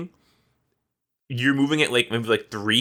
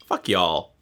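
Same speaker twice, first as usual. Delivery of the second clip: ordinary talk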